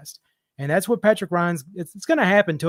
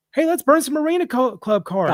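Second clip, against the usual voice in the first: higher mocking voice of an eager collector; mocking Jeff; 7 semitones higher, 3 dB louder than usual. To the caller, mocking Jeff's eager pitch. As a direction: lift the pitch and mock his pitch. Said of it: "and then".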